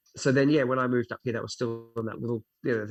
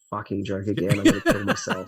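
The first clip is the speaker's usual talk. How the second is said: laughing exasperation; 2 semitones lower, 7 dB louder than usual. Retold or imitated. Joking about repeating himself, laughing.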